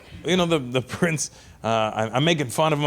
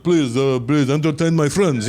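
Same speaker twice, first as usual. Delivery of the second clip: a hint of a formal royal pleading; mock-formal prince, slightly louder; stiff royal voice; 5 dB louder, slower than usual. Comic telling the host, mock-formal royal voice. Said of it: "He doesn't sound like how you're thinking".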